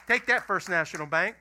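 loud mock-defiant boast; triumphant jab. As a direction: crow it loudly to the crowd. Triumphant boast at paying cash.

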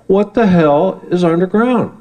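high, slow, indignant demand; outraged question. Real, not acted.

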